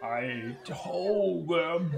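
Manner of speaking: very slow, soft, slurred mutter. A narrator's reading. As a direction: drag the words out as if drifting off. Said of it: "he spoke in a low-pitched slur".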